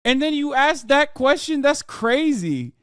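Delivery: can't believe they asked that; incredulous outburst. Real, not acted. Streamer reacting to a quiz, high-pitched disbelief.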